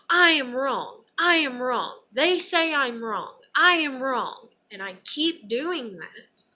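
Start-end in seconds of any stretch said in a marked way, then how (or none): 0.1-4.5 loud, higher anguished repetition; loud desperate self-accusation; screaming self-blame. Pretend. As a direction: yell it at yourself over and over. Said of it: "crying and screaming".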